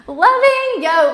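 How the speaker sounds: high, loud delighted cry; excited exclamation. Real, not acted. Teacher reacting to the chat, high and loud. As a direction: burst out with delight.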